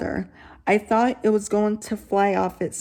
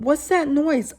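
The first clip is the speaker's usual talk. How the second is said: exclaimed; 6 semitones higher than usual. Acted. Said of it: "Arnold exclaimed".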